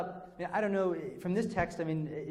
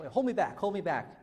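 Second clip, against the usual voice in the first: higher, mock pleading to be held back; higher, hammed-up please-stop-me plea; mock fake brawler; 5 semitones higher, 4 dB louder than usual. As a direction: ham up a guy who secretly doesn't want to fight. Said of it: "when someone wants to get in a fight and they go".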